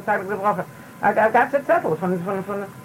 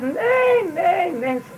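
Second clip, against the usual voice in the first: Rebbetzin's much higher, insistent no, no, no; high dismissive no; 12 semitones higher, much slower than usual. Retold or imitated. Rebbetzin brushing off the guest's question, high and insistent. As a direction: wave him off with a high, firm nein.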